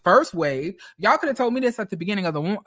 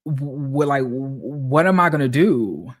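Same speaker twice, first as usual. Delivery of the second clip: ordinary delivery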